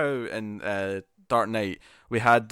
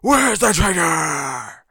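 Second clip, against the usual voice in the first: slow, loud, higher growled shout; gruff Bat voice shout; 6 semitones higher, 7 dB louder, much slower than usual. Retold or imitated. Mocking Batman's overdone line to his co-host, slow gruff shout. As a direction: bellow it like a hammy Batman. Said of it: "Too much".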